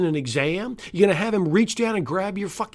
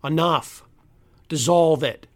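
ordinary talk, nothing marked

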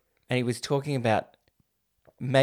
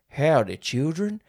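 Southern rooster; 4 semitones higher than usual. Retold or imitated. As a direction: imitate a storytelling rooster in a Southern American accent. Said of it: "that fucking rooster".

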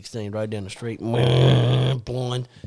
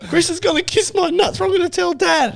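put-on high, sing-song kid tattling; tattling little brother. Little brother tells the older brother, high and smug. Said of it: "Jonathan's like".

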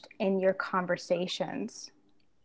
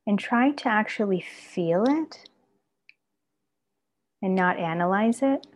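guided meditation; 3 dB louder than usual. Acted. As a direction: gently guide them into the body. Leads a body-scan meditation.

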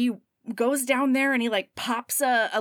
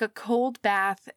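normal talking voice

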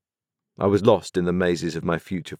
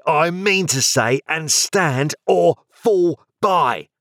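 Joe, crying out; 10 semitones higher, 4 dB louder than usual. A narrator's reading. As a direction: square up like a fighter. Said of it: "cried Joe".

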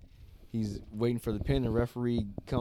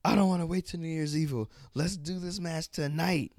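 eager challenge; 9 semitones higher than usual. Retold or imitated. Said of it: "Roxanne gets up and is like".

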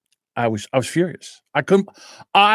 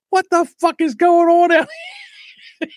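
very high mock shout; shrieky demand, played for laughs; imitated irate girlfriend. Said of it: "Now my girlfriend's up".

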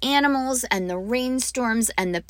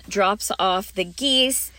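normal talking voice

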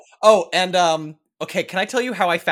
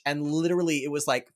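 ordinary talk

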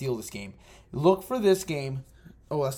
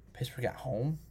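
quiet, musing question rising at the end; doubtful question; 10 dB quieter than usual. Real, not acted. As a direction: say "Pittsburgh at home?" softly, weighing it up. Musing over a game pick.

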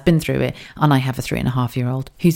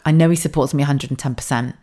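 ordinary talk, nothing marked

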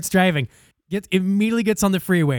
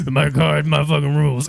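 put-on lower-pitched, stubborn old driver; low elderly voice, unmoved; old man; 4 semitones lower than usual. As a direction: play an old man who won't budge. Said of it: "ninety fucking year old man".

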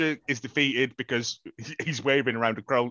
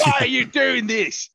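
high, loud Aussie official voice; Australian mayor voice; 9 semitones higher, 4 dB louder than usual. Retold or imitated. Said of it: "Australian mayor voice".

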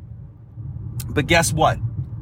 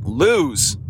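usual speaking voice

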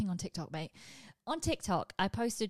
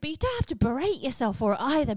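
ordinary talk, nothing marked

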